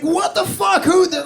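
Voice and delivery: high-pitched, incredulous protest; acted shock at your own thought; alarmed outburst. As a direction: shoot up high in mock alarm. Acts out alarm at intrusive thought.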